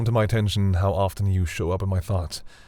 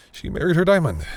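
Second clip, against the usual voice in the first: higher and louder, struck by it; startled reveal, higher and louder; surprised realization; 9 semitones higher, 5 dB louder than usual. Acted. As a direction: blurt out the shocking realization. Revealing shocking conclusion.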